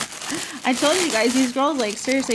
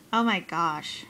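usual speaking voice

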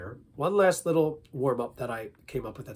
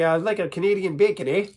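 louder, exaggerated Canadian accent; 5 semitones higher, 6 dB louder than usual. Shown as a demonstration. Accent warm-up, playful Canadian accent. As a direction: ham up Canadian with a tagged "eh". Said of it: "let's do Canadian".

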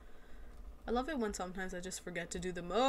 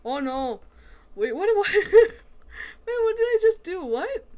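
loud, high, panicked confusion; high-pitched flustered yelping; freaking out; 12 semitones higher, 14 dB louder, slower than usual. Real, not acted. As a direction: squeal it in a laughing panic. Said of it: "I'm freaking out".

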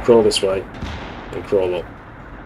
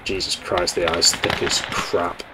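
muttered under breath; 3 semitones lower, 6 dB quieter, slower than usual. Real, not acted.

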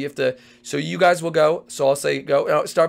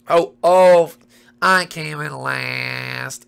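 mock sad slow; 3 dB louder, much slower than usual. Character voice mourning loss.

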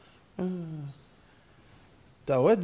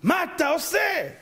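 high, drawn-out cry of disbelief; slow, wailing exasperation; exasperated outcry; 12 semitones higher, slower than usual. Acted. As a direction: stretch the question out, pitched way up. Same question escalated into outcry.

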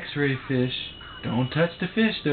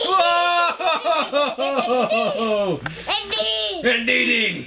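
laughing, high delighted praise; excited cheer; 12 semitones higher, much slower than usual. Real, not acted. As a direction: celebrate the right answer. Cheering toddler's answer.

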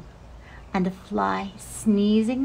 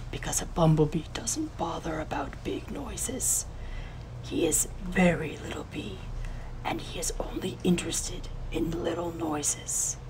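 hushed whisper in a changed voice; groundhog whispering; 6 dB quieter than usual. A narrator's reading. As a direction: whisper the secret like a wise old groundhog. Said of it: "I will whisper to you".

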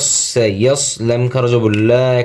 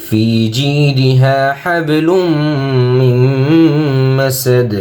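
slow, melodic tajweed chant with long drawn vowels; Quran recitation; 4 semitones higher, much slower than usual. Acted. Teacher reads the whole surah to students, melodic chant.

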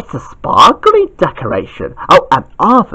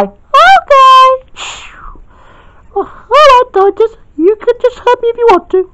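put-on high girl voice. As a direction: beg Titus, high and dramatic. Girl figure asks to be hit.

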